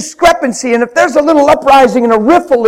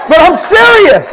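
earnest shout; 6 semitones higher, 4 dB louder than usual. Real, not acted. Preacher insisting he means it.

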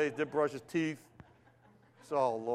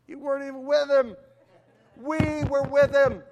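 higher, louder, slowed indignant protest; indignant apostles; 8 semitones higher, 5 dB louder than usual. Retold or imitated. Apostles protesting to Paul, indignant and raised. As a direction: push back hard, offended.

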